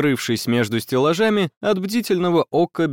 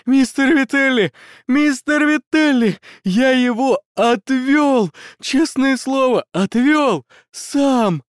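high, loud, choking cry; shout, choking on the words; sputtering shout; 11 semitones higher, 4 dB louder, slower than usual. Acted. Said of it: "закричал, захлёбываясь".

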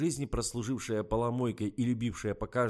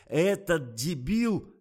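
louder, slower howl, falling at the end; outraged yell; 7 semitones higher, 7 dB louder, much slower than usual. A narrator's reading. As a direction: stretch the insult out in fury. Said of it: "завопил Николай".

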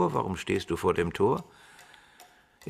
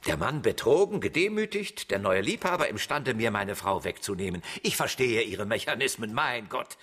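rising higher and higher in mocking outrage; mock the simple-minded theory, climbing up in pitch; scathing, incredulous scorn building to a high squeak; scornful indignation; 6 semitones higher than usual. Professor mocks the inspector's theory.

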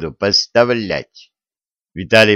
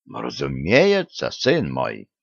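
put-on low old patriarch voice; 8 semitones lower, 5 dB quieter, slower than usual. A narrator's reading.

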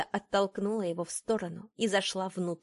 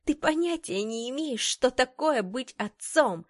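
higher-pitched indignant retort; heated reproach in a raised pitch; 6 semitones higher, 4 dB louder than usual. Acted. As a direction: throw it back at him, voice lifted. Acted quarrel with the father.

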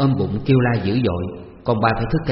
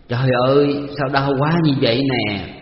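slow, pained moan, a little higher and louder; 3 semitones higher, 3 dB louder than usual.

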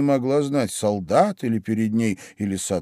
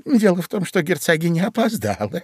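high, hurried-sounding confidential voice; rabbit confiding; 6 semitones higher than usual. A narrator's reading. Rabbit explains the sentence to Alice, confiding. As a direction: confide it in a raised small voice. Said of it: "зашептал кролик".

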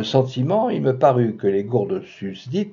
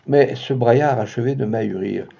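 usual speaking voice

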